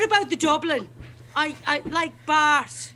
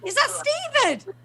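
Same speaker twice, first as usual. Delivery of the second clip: real high squeal of surprise, slowed; delighted surprise; 12 semitones higher than usual. Real, not acted. [name] recognises Stephen on the video call, squealing with delight.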